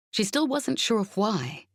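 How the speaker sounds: ordinary delivery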